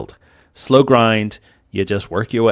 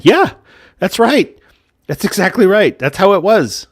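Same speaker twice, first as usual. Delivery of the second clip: heated, higher and louder rant; getting angry; 9 semitones higher, 5 dB louder than usual. Real, not acted. Gamer ranting at players who complain, angry. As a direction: let the frustration boil over. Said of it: "that got a little angry".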